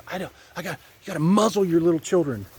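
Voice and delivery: odd-sounding sinister mock voice; a light villain voice; voice of the enemy. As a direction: play the enemy, sneering. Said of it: "The enemy says".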